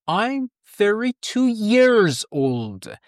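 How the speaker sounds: teacher modelling slowly; slow full answer. Shown as a demonstration. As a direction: draw out the full sentence.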